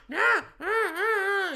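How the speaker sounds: imitated high-pitched whine; whining refusal. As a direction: mimic a struggling customer's whiny protest. Imitated customer refusing and struggling.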